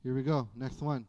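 ordinary talk, nothing marked

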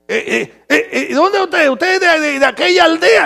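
imitated questioner. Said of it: "le preguntó a los hermanos".

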